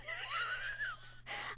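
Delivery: shrill squeal of a laugh, far up; very high shrieking laugh. Real, not acted. Streamer shrieking with laughter at her own voice.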